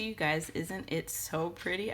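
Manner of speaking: usual speaking voice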